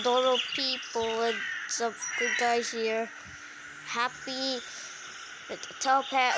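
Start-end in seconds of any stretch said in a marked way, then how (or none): none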